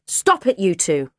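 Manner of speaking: high, loud order to stop; sharp acted shout; urgent cry. A narrator's reading. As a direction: cry out to break up a fight. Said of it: "I cried".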